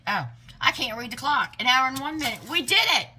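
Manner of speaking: high, loud cheer building to the end; real triumphant outburst; excited celebration. Real, not acted. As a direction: celebrate it loudly, pitch climbing. Celebrates finishing in an hour.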